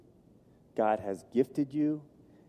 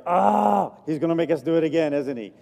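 playful imitation of a reluctant crowd, loud and quick; loud, fast mock complaint; mock groan; 4 semitones higher, 6 dB louder than usual. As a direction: whine it like a crowd dreading a repeat. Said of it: "I know you're like".

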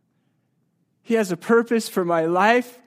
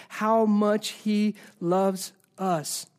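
slow emphasis; 3 dB quieter, much slower than usual. Real, not acted. Preacher stresses God's love.

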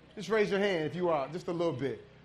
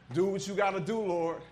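normal talking voice

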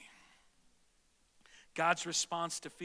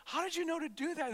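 amazed question; 9 semitones higher than usual. Retold or imitated. Husband amazed at wife with baby.

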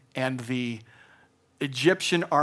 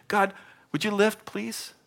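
much higher, pleading little plea; mock pleading prayer; 8 semitones higher than usual. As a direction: ask God to lift it, half-joking. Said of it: "your authentic, real heart feelings".

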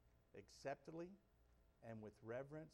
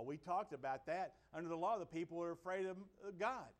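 raised, louder preaching, building with joy; full-force preaching; 6 semitones higher, 9 dB louder than usual. Acted. Pastor rousing the church about celebrating, preaching at full force. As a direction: preach it high and loud to the congregation.

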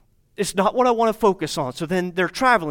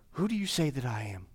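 intimate hush; 7 semitones lower, 12 dB quieter, faster than usual. Retold or imitated. Jesus looking into your eyes.

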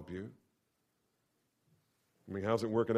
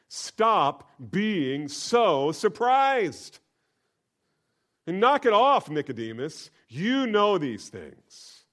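slow, much higher and louder rebuke; loud rebuking preaching; 8 semitones higher, 10 dB louder, slower than usual. Acted. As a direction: scold Nicodemus from the pulpit. Preacher rebukes Nicodemus loudly.

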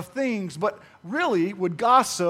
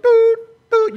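high, loud sonar doot doot; sonar beeps; 13 semitones higher, 10 dB louder than usual. Shown as a demonstration. Pastor mimicking sonar ears to the congregation, high beeps. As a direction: beep like a sonar scanning for gossip. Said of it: "You put those ears in sonar mode".

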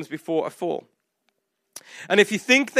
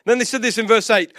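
usual speaking voice

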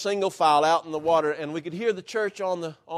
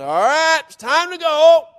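loud, much higher call across the picnic; 11 semitones higher, 11 dB louder than usual. Retold or imitated. Overalled leader tells the congregation to leave, loud call. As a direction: call the whole church home.

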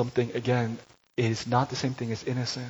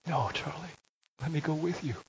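gentle pleading; 5 semitones higher, 5 dB quieter than usual. Retold or imitated. Father pleads with son.

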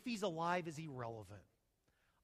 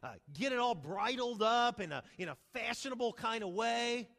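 pompous Haman; 4 semitones higher, 5 dB louder than usual. Acted. Haman, imagining his own honour, grandly. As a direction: savour each word like a vain man picturing his own parade. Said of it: "He says".

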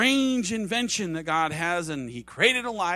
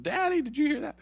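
much higher-pitched imitation of a child; little boy; 10 semitones higher, faster than usual. Preacher imitates a boy asking his father, childlike. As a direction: pipe up like a small boy. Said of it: "one of the little boys spoke up".

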